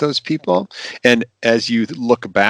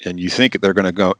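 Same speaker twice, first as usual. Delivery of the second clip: ordinary talk, nothing marked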